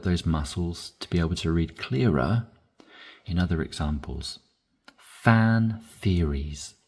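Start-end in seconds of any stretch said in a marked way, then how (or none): 5.0-6.7 Fan theories said very slowly, F then the TH clearly; F to TH sound. Shown as a demonstration. Teacher drilling the F to TH switch, very slow. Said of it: "To switch from an F to a T H sound".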